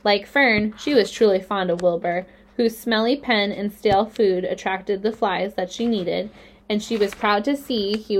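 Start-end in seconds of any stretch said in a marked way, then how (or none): none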